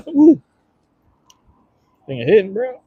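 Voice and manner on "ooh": loud cough into a high ooh; cough and gasp. Real, not acted. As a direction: cough hard, then gasp at the heat. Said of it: "I'm trying to cough with the green sauce".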